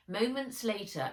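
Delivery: ordinary delivery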